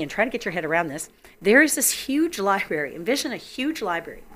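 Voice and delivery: ordinary talk, nothing marked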